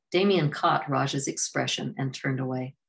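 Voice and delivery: ordinary delivery, nothing marked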